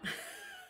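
very high, soft giggle; a tiny high giggle; squeaky laugh. Real, not acted. Laugh after week remark.

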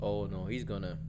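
ordinary talk, nothing marked

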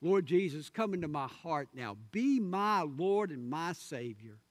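ordinary talk, nothing marked

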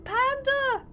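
ordinary talk

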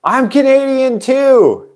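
high, loud, stretched mock yell in another voice; a passer-by teasing with a yell; mocking shout. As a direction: stranger yelling back to join in. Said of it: "he's like".

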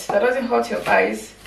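normal talking voice

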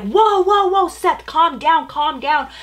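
high, loud whoas easing down; alarmed calming shouts. Retold or imitated. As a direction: imitate a group urgently settling someone.

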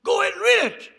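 high, loud retold insistence; loud urging. Lynn pressing the teacup reader to read, loud urging.

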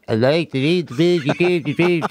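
hammed-up British accent of an auditioning actor; joking put-on British accent; mock audition accent. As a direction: imitate the prince's odd British accent. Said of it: "going in on the audition".

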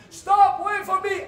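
high, loud yell after a departing ship; frantic high-pitched shouting across the water; desperate shout. Retold or imitated. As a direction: scream at the ship to wait for you. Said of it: "I just shouted".

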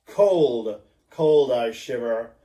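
a touch of a man chilled to the bone. Acted. Ahab alone on deck, shivering and brooding.

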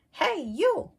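high, loud, cheeky challenge; grouchy ladybug voice. Acted. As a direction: pick a fight in a squeaky bug voice. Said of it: "said the grouchy ladybug".

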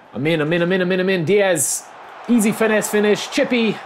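high, loud goal celebration; celebrating his own chipped finish; excited cheering. Real, not acted. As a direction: chant it as the ball goes in. Scoring a goal.

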